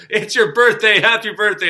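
big, high-pitched mock surprise; playful teasing. Neighbor messing with her as he congratulates her, playful teasing. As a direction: loudly feign a sudden birthday realization. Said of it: "totally, he was totally messing with her".

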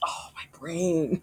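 breathless whispered groan. Real, not acted. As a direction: whisper it to yourself, dazed. Overwhelmed by odd question.

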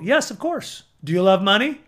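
ordinary talk

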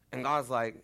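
normal talking voice

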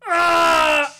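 high, loud comic scream; one sudden high-pitched yell; Pee Wee Herman. Acted. Co-host giving a micro impression, loud high scream. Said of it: "my Pee Wee Herman micro impression".

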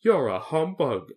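hurt, drawn-out reproach; grieved tone. A narrator's reading. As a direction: accuse sorrowfully, dragging the words. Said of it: "said the Scarecrow in a grieved tone".

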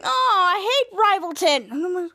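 high, slow mock groan; acted "ugh, them" complaint; exasperated disgust. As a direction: draw out the loathing, hammed-up. Character groans about rival town.

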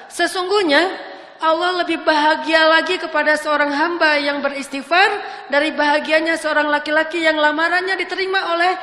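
a preacher lifting the hall with his point; preaching at full force. Acted. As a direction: proclaim it to the whole congregation. Preaching climax.

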